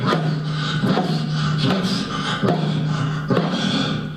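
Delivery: breathy bear grunts "ruff"; bear chomping grunts. Acted. Storyteller voicing Brother Bear gobbling salmon, breathy bear grunts. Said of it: "he started going".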